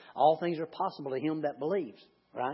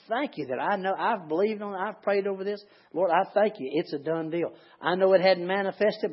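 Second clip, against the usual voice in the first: prayer of thanks, building higher and louder; climbing, fervent thanksgiving prayer; earnest prayer; 4 semitones higher, 4 dB louder than usual. Acted. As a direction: pray it out with rising conviction. Voiced prayer of thanks rising.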